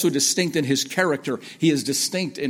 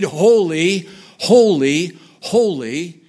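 loud, raised "Holy, holy, holy"; each "holy" louder, higher and separate, rising at the end; building emphasis; 3 semitones higher, 9 dB louder, much slower than usual. Shown as a demonstration. Preacher showing emphasis by repetition, ringing "Holy, holy, holy". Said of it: "beginning to see some of that emphasis".

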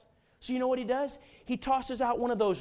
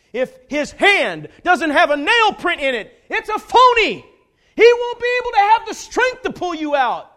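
full-force preaching; 11 semitones higher, 15 dB louder than usual. Real, not acted. Preacher telling the congregation only Christ can save, full-force preaching. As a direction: thunder the gospel with all your force.

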